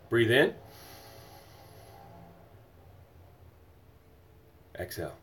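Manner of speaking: soft, low breathing cues; soft breathwork guidance; guided breathing. Acted. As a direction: guide the breaths gently and calmly. Leads breathing exercise.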